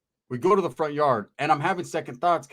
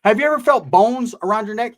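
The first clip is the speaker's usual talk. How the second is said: ordinary delivery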